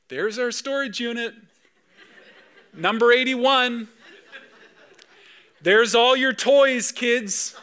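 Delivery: high, slow, loud and laughing; pointing out to the kids. Retold or imitated. Father showing his kids the storage unit, laughing and high. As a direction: play it up as a wistful tour guide to his kids. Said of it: "I'd often point out to the kids".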